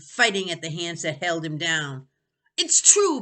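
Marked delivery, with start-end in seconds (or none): 2.6-3.2 much higher, louder shout. Acted. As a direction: shout it back. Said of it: "Benny shouted back".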